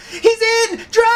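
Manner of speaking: shrill, very high, loud burst of triumph; high mock shout to the driver; gleeful getaway cry. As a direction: yell it like the kid just bagged him. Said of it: "And then you hear".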